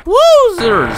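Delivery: high, loud burst of surprise; startled exclamation. Real, not acted. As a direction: yelp it out in sudden surprise. Startled exclamation during play.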